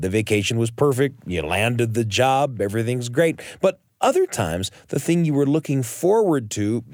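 ordinary talk

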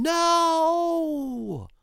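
high drawn-out innocent no; innocent child. Retold or imitated. Boy stopping mother checking baby, high and innocent. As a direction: sing it out sweetly to fool her.

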